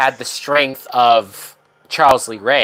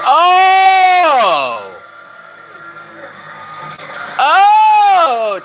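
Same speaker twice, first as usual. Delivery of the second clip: high, loud shocked yelp; shocked shout; 4 dB louder than usual. Real, not acted. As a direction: cry out in real shock. Chucky's eyes pop out.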